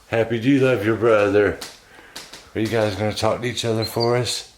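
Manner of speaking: usual speaking voice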